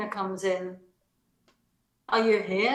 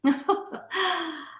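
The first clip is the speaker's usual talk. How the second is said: a short, light, amused laugh; 7 semitones higher than usual. Acted. Elena laughing at Astrov's comic speech, amused laugh.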